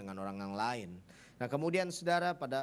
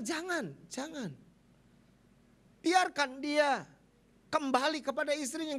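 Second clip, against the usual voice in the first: urgent retold plea; 14 semitones higher, 5 dB louder than usual. Pastor urges brother to let him return, loud plea. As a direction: plead loudly, stretching out the words.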